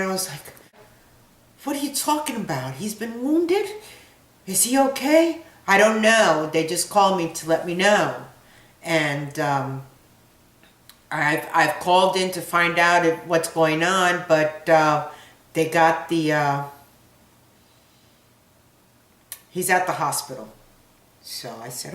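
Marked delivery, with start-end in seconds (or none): 1.6-5.4 high, rising alarm, getting louder; a mother hearing her son is wounded; panicked questions. Retold or imitated. Mother's panicked reply on phone. 5.7-20.5 loud, urgent explaining; father shouting the news down the phone; retold loud phone talk.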